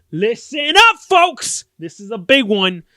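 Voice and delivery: loud, high barker's shout; rally the crowd for big news; hyped call-out. Pretend. Vlogger calling viewers to attention, hyped shout.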